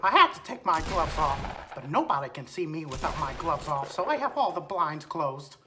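soft cartoon mouse with swooping pitch; Mickey Mouse. Mouse answering about fluffy fingers, cartoon voice. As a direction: explain it in a mascot squeak. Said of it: "one v one Mickey Mouse".

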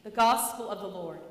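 solemn closing proclamation; liturgical acclamation. Acted. Priest ending the Gospel reading, solemn. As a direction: close the reading with ceremony.

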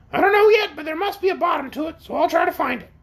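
high-pitched, brisk creature voice; determined orc. Acted. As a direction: answer quickly up high as a willing orc.

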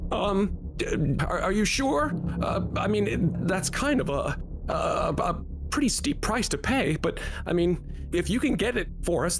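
nervously waver, then give in; hesitant buyer. Acted. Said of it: "another voice says".

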